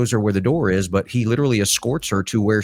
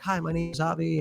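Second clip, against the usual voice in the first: high, soft little voice; sweet intern greeting; 9 semitones higher, 8 dB quieter than usual. Retold or imitated. Daughter introducing herself to coworkers, high and soft. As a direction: lightly play the shy new intern.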